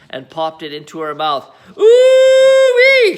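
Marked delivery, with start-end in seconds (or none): 1.8-3.2 put-on very high, loud old-woman shriek; thrilled grandma squeal; gleeful scream. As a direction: shriek with wild delight. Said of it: "she screamed".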